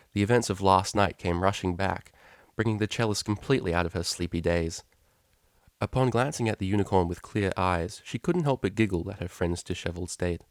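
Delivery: ordinary delivery, nothing marked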